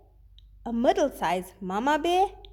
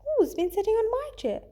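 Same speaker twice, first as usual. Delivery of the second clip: much higher motherly bear voice; Mama Bear; 9 semitones higher, faster than usual. Acted. Teacher voicing Mama Bear for her students, much higher. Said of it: "Mommy Bear says".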